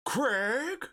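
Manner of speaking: high-pitched call of Craig like the Californians sketch; mock Valley-accent shout of the name. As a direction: fling the name out like a soap-opera Valley type. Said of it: "I love that sketch".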